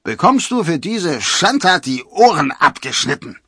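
high, loud angry threat; outraged threat. A narrator's reading. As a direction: threaten punishment at full voice. Outraged forester threatens Robin.

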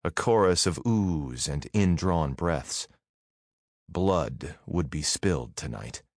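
ordinary delivery

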